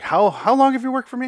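high, loud, cutting interrogation; angry boss yelling. Retold or imitated. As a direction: demand an answer from an employee. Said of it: "Margaret is yelling at Courtney".

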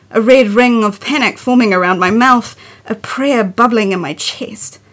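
measured, earnest poem recital; poetry reading. Acted. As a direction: read it like a confession poem. Continues reading her poem.

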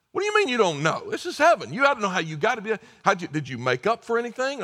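high, loud, pressing questions; heaven's gatekeeper grilling the thief, high and insistent; incredulous interrogation. Acted. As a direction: demand an answer, baffled.

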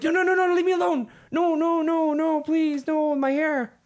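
high, loud frantic begging; panicked pleading. Retold or imitated. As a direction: beg for mercy in a shrill panic. Acting out the victim begging.

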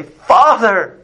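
high, beseeching call to the Father; pleading cry. Acted. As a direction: cry out for mercy from the depths. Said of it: "all we can sit there and do is say".

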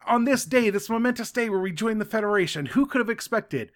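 high-pitched mock wonder; amazed crowd. Crowd seeing the orbs on Federation day, mock amazement. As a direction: play onlookers marvelling at a momentous surprise. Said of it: "so they're like".

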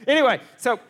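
loud, high call back to the point; shout to refocus. Real, not acted. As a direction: reel the room back in. Shouting to regain the crowd.

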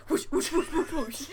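whispered whoosh sound effects; breathy whoosh, whoosh; whooshing swing noises. Retold or imitated. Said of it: "both of them are like".

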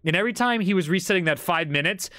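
normal talking voice

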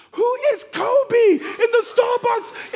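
high, loud, slow yell across the room; shouting at the top of her voice; daughter's shout. Retold or imitated. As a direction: bellow it like a kid who doesn't care who hears. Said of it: "shouts at the top of her voice".

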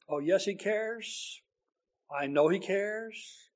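quiet, slow, tender assurance of care; tender, hushed. Real, not acted. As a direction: soften right down, sincere. Tender aside about God's care.

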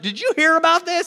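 high, loud call between boats; excited gossip. Acted. Acting fishermen passing on news.

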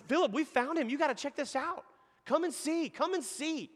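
high-pitched, excited invitation; eager urging. Retold or imitated. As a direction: urge a friend along, voice lifted high. Disciples urging Philip to come along.

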